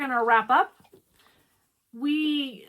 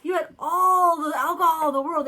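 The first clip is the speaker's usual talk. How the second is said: loud, high imitation of awed kids; mock-awed kids exclaiming; impressed kids; 6 semitones higher, 10 dB louder than usual. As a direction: draw out ALL in loud amazement. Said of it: "They were so impressed".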